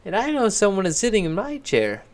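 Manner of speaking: a small cub piping up; Little Bear. A narrator's reading. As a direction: cub crying out about his chair. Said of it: "said Little Bear".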